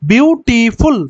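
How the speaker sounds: বিউটিফুল slowly in three drawn-out beats, বিউ-টি-ফুল; syllable by syllable. Shown as a demonstration. Teacher counting the beats in বিউটিফুল, slow and syllable by syllable. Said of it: "কয়বার উচ্চারণ করতে হচ্ছে".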